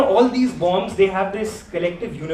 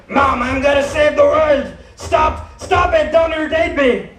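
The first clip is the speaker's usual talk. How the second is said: mock Batman yell; 4 semitones higher than usual. Batman yells at his mom.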